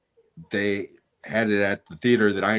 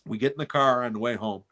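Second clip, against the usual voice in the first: ordinary delivery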